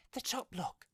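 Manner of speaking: high-pitched desperate character voice; old woman crying out. A narrator's reading. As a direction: call urgently in a high put-on voice. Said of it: "she cried loudly".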